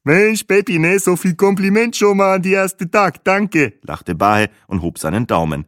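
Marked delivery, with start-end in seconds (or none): 0.0-3.7 high, lively guide voice; cheerful tour guide. Acted. Bahe thanks Peppi for his compliments, cheerful and high. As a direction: gush thanks in a pitched-up voice.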